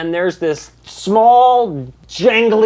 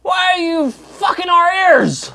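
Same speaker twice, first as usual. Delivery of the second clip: angry townsperson; 13 semitones higher than usual. Acted. Townsperson yells at the player ringing the bell. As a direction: bark at the noisy bell ringer.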